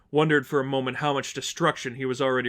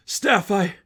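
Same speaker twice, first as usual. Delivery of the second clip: excited relief; 8 semitones higher, 8 dB louder than usual. Acted. Tim hugging Stephanie.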